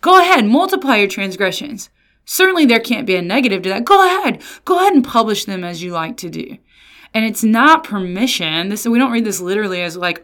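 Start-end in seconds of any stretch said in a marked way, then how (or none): 0.0-6.6 sarcastic derision. Retold or imitated. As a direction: taunt them with fake permission. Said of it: "here's some derision from the Lord".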